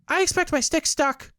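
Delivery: very high, loud little kangaroo cry; high squeaky baby kangaroo; excited Roo cry. A narrator's reading. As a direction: squeal it out like a tiny excited joey. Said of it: "said Roo".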